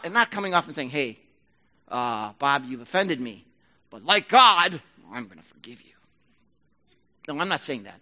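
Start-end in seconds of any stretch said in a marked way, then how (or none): 0.9-5.9 arrogant forgiveness. Pretend. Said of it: "That's arrogance".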